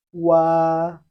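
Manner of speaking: loud, held wa for students to copy; Chinese wa. Shown as a demonstration. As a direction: model wa clearly. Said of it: "must be read before the R sound".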